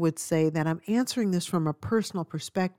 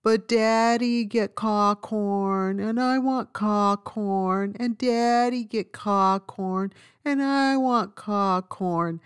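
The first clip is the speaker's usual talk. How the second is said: put-on slow, high whining little boy; whiny toddler voice; 3 semitones higher, slower than usual. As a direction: whine it like a crying toddler. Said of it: "he started repeating himself".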